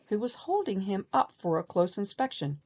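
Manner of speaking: plain reading voice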